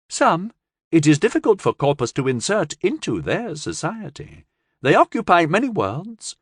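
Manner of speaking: lightly voiced alien. Alien explains.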